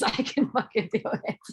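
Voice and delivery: laughing through a defiant vow; half-laughing as she swears she'll do it; giggling, mock-determined. Laughing at her own resolve.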